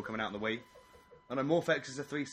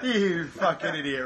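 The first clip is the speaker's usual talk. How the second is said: laughing insult; 4 semitones higher, 7 dB louder, slower than usual. Real, not acted.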